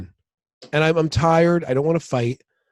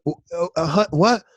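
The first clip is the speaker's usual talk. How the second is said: flustered, offended; 6 semitones higher than usual. Retold or imitated. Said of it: "I was like".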